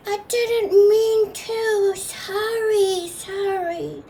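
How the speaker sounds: put-on high, drawn-out apology in a child voice; plaintive little kid, fading on each sorry; little grandson. Little grandson apologizing to grandpa, high slow plaintive whine. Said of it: "little grandson was like".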